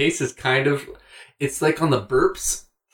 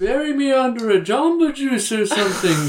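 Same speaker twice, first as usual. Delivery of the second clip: ghostly voice; 8 semitones higher than usual. Pretend. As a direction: call out like a ghost. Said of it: "Are you sure you're Matt's ghost?".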